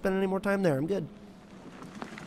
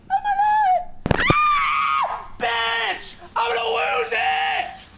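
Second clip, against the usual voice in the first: screaming in fear; 7 dB louder, much slower than usual. Real, not acted. Jump scare in horror game.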